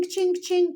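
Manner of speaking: mock till ka-ching. Telling viewers about the two grand bet, high mock till chime. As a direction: ring it out like a cash register.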